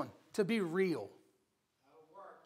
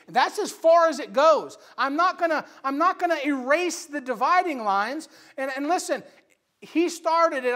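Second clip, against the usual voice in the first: raised, louder preacher's rebuke; forceful preaching; 7 semitones higher, 8 dB louder than usual. Retold or imitated. Preacher recounting his rebuke to a fellow believer, forceful preaching. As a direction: drive the argument home, high and loud.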